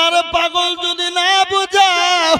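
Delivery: high, loud retold rebuke; acted exasperated outburst; mock scolding. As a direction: scold a fool, drawing out the ending. Retelling the villagers' scolding reply.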